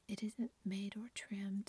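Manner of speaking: plain reading voice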